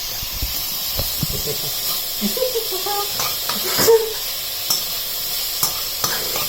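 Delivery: long high breathless laugh; helpless wheeze of laughter; wheezing silent laugh. Real, not acted. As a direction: laugh until no sound comes out.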